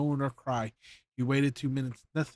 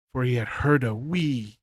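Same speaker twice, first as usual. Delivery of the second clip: plain reading voice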